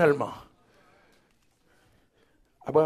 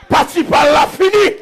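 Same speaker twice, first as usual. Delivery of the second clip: high shouted refrain in short punched bursts; full-force preaching; 8 semitones higher, 4 dB louder than usual. Real, not acted. Preacher declaring to the congregation that it is finished, full-force preaching. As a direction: hammer each phrase out to the congregation.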